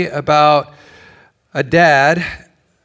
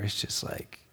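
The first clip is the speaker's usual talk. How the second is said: ordinary talk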